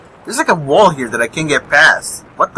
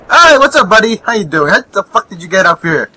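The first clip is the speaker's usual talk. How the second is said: mock friendly taunt; 7 semitones higher, 5 dB louder than usual. Real, not acted. Taunting enemy player.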